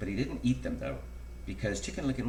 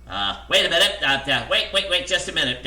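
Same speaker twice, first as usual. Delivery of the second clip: loud, raised, stammering interruption; urgent cry; 6 semitones higher, 9 dB louder than usual. A narrator's reading. Jack the narrator breaking into the story, urgent loud cry. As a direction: cut in hurriedly and loudly. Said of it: "cried Jack the narrator".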